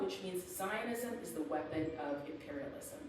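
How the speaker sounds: ordinary talk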